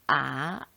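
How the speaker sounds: "아" low, dipping then rising; third Mandarin tone. Shown as a demonstration. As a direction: sink low on "아", then curve up. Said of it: "사성이 있다고 그러죠".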